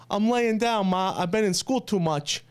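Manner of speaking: imitating his child self with an Italian accent; heavy Italian accent. Six-year-old answering his mother on tape, heavy Italian accent. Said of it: "I have a heavy accent".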